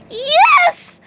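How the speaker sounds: high, loud, triumphant yell; excited shout. Acted. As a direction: shout it out with glee. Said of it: "shouted SpongeBob".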